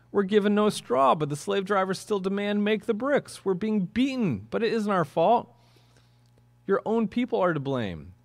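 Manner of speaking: high-pitched, indignant begging read aloud; pleading. A narrator's reading. Israelite foremen protest to Pharaoh, pleading. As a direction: act out a desperate appeal, pitched well up. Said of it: "pleaded with him".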